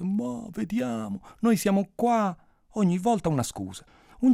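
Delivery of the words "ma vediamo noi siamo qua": put-on slow, higher-pitched old man's brush-off; imitated old man stalling, drawn out; evasive old storyteller. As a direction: stretch it out, fobbing someone off. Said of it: "ma lui niente".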